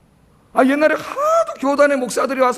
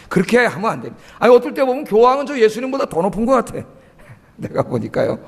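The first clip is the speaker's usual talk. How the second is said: ordinary talk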